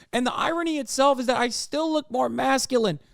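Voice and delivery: ordinary delivery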